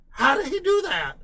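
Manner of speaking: put-on the chipmunk kid crying out in disbelief; chipmunk voice scream. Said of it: "and he screamed".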